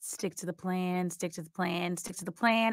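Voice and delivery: hushed, low mantra to herself; anxious inner monologue repeated under the breath; whispered inner voice. Acted. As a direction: murmur it like a thought you can't shut off. Alice's racing thoughts film voiceover.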